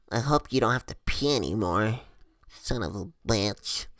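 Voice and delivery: retold low grumble. Driver grudgingly warns him.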